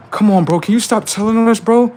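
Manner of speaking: high, loud whiny begging voice; mock pleading AI. AIs voiced pleading again.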